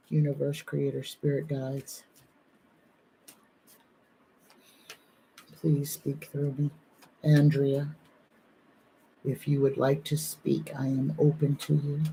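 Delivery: soft, low-key prayer to the spirits; hushed invocation. Real, not acted. Medium asking spirit guides to speak through her, hushed prayer. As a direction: call the spirits in quietly, reverently.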